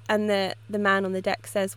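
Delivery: ordinary delivery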